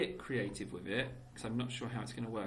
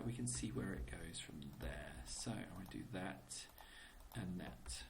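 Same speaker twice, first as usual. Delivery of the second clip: whispering; 6 semitones lower, 10 dB quieter than usual. Real, not acted. Drops to a whisper.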